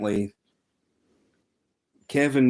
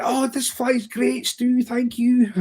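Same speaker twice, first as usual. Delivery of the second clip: much higher, slow mock-delighted kid voice; imitating the boy; 13 semitones higher, slower than usual. Kevin thanking Stu after his catch, much higher slow mock voice. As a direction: drag out the thanks like a thrilled youngster.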